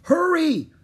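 high, loud urging shout; excited high-pitched call. A narrator's reading. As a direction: holler for someone to hurry. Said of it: "called Annie".

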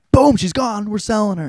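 high, loud, sudden outburst; acted loud announcement. Retelling owners selling off the runaway's loved one, acted loud announcement. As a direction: boom it out as an abrupt verdict. Acts out the owners' abrupt verdict.

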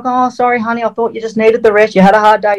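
imitating the wife. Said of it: "She's like".

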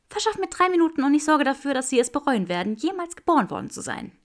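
high, fast, fierce promise; fierce woman. A narrator's reading. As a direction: vow it fiercely.

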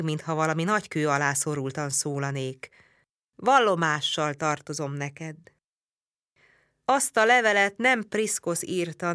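usual speaking voice

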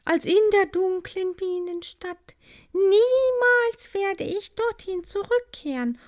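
high, loud, defiant joy; ecstatic vow. A narrator's reading. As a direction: swear it with fiery delight. Maya vows never to return.

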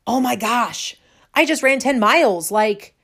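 high, loud, breathless with excitement; thrilled call to a best friend, loud and high; excited retelling. Retold or imitated. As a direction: gush like you can't believe it yourself. Calling friend after ten-mile run.

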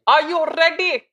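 high, loud rallying call; hype shout. Pretend. Host firing up co-host for the segment, loud hype call. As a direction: belt it out to fire up a partner.

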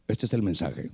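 very low, hushed divine voice; voice from outside. Retold or imitated. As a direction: deliver it deep and weighty, like a voice from God. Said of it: "y me dice".

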